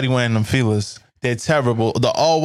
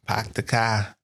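softer Boston accent, echoing; quiet put-on Boston accent; mock Boston accent; 3 semitones lower, 6 dB quieter, slower than usual. Shown as a demonstration. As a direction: echo it softly in a Boston accent. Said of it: "We sound like that?".

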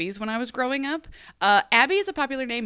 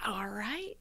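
whispered reply; 12 dB quieter than usual. Retold or imitated. Psychic's reply in recap.

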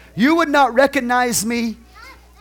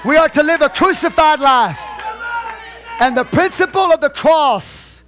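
loud, higher shouted preaching; preaching at full force; 7 semitones higher, 5 dB louder than usual. Real, not acted. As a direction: drive it home to the church.

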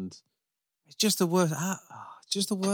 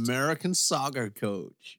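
slow, lightly put-on American accent. Co-host teasing about the new manager in an American accent. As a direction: drawl it like an American coach. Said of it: "made me do a shitty American accent".

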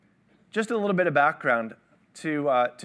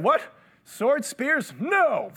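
much higher mock disbelief; incredulous Peter; 10 semitones higher, 3 dB louder, much slower than usual. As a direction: scoff at the idea. Peter voiced in the garden.

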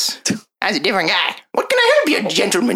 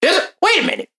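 high startled gasp of a gnome; hammed-up surprise; startled shopkeeper; 11 semitones higher, faster than usual. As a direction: jump at the fake goblin, pitched way up. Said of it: "he goes".

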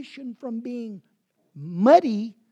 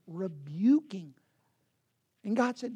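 ordinary talk, nothing marked

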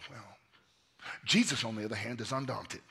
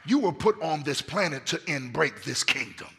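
raised, emphatic commission to a follower; rousing preaching as Jesus; 4 semitones higher, 4 dB louder than usual. Acted. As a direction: deliver the calling with pulpit force. Preaches as Jesus, full force.

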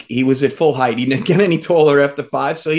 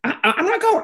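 high, stammered mock refusal; high-pitched digging in; Ernie; faster than usual. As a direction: imitate a friend balking, pitched way up. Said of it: "and Ernie goes".

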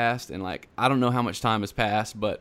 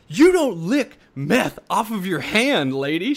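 indignant retort; 8 semitones higher, 4 dB louder than usual. Retold or imitated.

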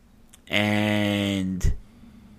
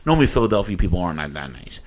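normal talking voice

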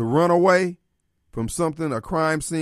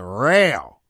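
Chappelle impression; 6 semitones lower than usual. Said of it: "Dave Chappelle".

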